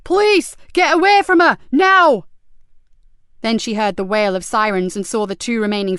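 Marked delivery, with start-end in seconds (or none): 0.0-2.2 high, loud police shout across a car park; shouting police officer. A narrator's reading. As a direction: bellow the warning from afar. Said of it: "a loud shout echo".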